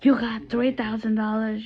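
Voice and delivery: lightly voiced grumpy man; a hint of an angry old man; imitating the man. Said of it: "the man said".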